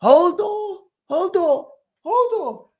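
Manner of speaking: high desperate wail; Hodor cry. Retold or imitated. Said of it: "what's his name?".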